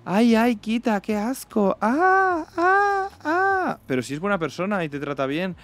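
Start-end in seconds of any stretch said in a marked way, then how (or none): 0.0-3.8 very high, squealing mock disgust; mock disgusted shriek. Mocking a girl recoiling from a short guy, squealing very high. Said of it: "Es como".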